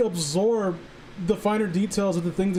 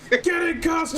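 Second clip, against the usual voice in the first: playful shouted order to a friend; joking shout; 10 semitones higher than usual. Pretend. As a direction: yell it like a comic order. Shouted joke summons.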